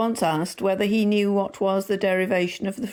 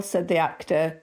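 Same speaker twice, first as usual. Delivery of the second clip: plain reading voice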